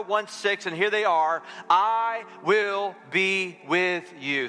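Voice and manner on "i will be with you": slow, each word weighed; slow solemn promise; slow emphatic declaration. Shown as a demonstration. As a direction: lay it down slowly for the church to repeat. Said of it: "Say that with me".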